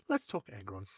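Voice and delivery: low, soft reset; suddenly hushed introduction; dropped aside. Acted. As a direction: bring it right down after the yelling. Hushed reset after the war cry.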